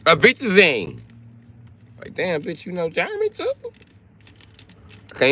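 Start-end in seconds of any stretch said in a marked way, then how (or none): 0.1-1.0 high-pitched fake German; mock German. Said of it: "Bitches is on there speaking German". 2.1-3.5 very high, soft German-discovery disbelief; mock amazement. Acting out an amazed listener.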